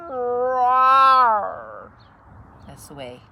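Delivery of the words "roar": big, higher, much louder roar; yelled roar. Acted. Cat yelling at the sleeping bear, big loud roar. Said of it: "and yelled".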